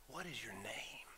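near-whispered gentle question; hushed, tender and calm; most gentle. Acted. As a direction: ask very softly and low, almost under your breath. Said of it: "Is that not the most gentle?".

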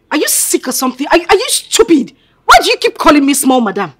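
high-pitched, indignant telling-off; irritated scolding. Acted. Madam scolds a staff member for calling her small madam, irritated.

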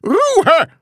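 very high, loud yell for silence; shouting. A narrator's reading. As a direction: scream it at the whole class. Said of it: "schrie Herr Groll noch einmal". (lauter, hoher Schrei)

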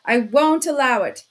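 loud outburst of fury; shouting. A narrator's reading. Suspect banging the table at the investigator, shouting. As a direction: shout it with force on "won't". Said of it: "he shouted".

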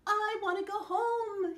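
high, loud, breathless run; panting. A narrator's reading. Mocha the dog racing down the mountain, panting. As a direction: gasp it out while fleeing. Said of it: "he panted".

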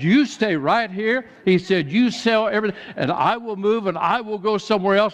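urging him to stay, backing down; eager vendor. Retold or imitated. Apologetic Greek vendor yielding the spot to the pastor.

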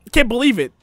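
loud, disbelieving complaint; can't believe what just happened; shocked outrage. Real, not acted. Character just got bitten.

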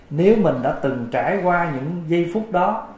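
normal talking voice

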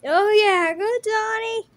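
loud high cheer; excited shout of support. Acted. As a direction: cheer your brother on. Brother cheers on Donnie.

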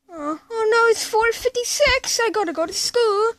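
high, fast panicky rush; panicked. Acted. Panicked realizing the time.

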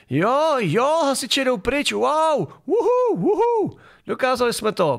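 high, squealing mock celebration; mock cheering. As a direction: whoop like a gleeful protester, hammed up.

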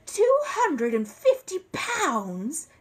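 high, loud, slow disbelief; aghast exclamation at a huge price; shocked outcry. Acted. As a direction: stretch out every word in astonishment.

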